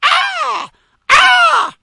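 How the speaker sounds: two piercing high shrieks; bird squawk. Retold or imitated. Raven squawks over Ahab's supper table, shrill shrieking. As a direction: screech like a raven swooping in. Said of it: "something goes".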